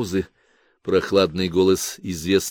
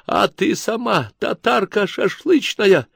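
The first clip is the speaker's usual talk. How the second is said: much higher, slow tearful wail; wailing and sobbing; 10 semitones higher, slower than usual. Acted. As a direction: wail it as you burst into tears. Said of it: "завопила вдруг и зарыдала Тамарка". (завопила и зарыдала)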